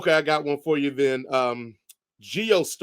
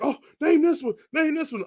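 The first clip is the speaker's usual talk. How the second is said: pitched-up pretend shouting; mock yelling listeners; 11 semitones higher than usual. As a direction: imitate impatient listeners calling out suggestions. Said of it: "people are probably yelling at this thing".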